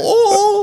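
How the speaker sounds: high mocking "oh"; a shrill, hammed-up shriek. Woman screams at a head in her lap, mock shriek. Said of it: "she's all".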